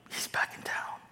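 hushed gossip whisper; whispered aside. Retold or imitated. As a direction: breathe it like a secret. Pastor retelling churches whispering gossip.

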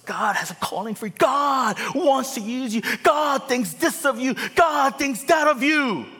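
high, building preacher shout; hammed-up prophetic shouting; mock hype shout. Pastor shouting encouragement at Moses.

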